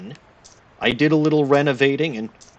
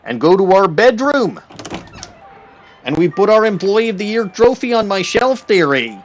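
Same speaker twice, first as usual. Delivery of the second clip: mock Canadian accent. Streamer narrates the game to chat in a jokey Canadian accent. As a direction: play it folksy Canadian, tacking on there, eh. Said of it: "turned me all Canadian there".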